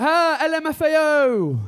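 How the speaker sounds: put-on loud, high, drawn-out kid's jeer. Kid in the street laughing at his look, jeering. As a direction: yell it slowly like a mocking kid. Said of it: "some kid was like".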